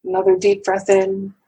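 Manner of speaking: unhurried phrases, leaving room to breathe; guided meditation. Acted. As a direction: guide listeners gently into calm, breath by breath.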